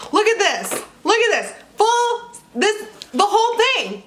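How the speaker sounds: building glee over the bottle; excited. Real, not acted. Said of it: "I'm freaking out".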